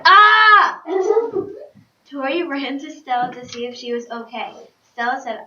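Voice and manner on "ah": very loud, high-pitched scream; loud cry. A narrator's reading. Dragon falling to the ground, loud high yell. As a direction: blast out a sudden high "Ah!". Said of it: "fell to the ground with a loud".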